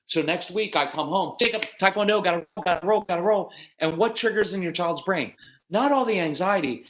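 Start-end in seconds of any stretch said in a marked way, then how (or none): 1.4-3.5 brisk, higher-pitched calling out; hurrying a child out. Acted. Parent heading to Taekwondo, urging hurry. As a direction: lightly rush the kid out the door.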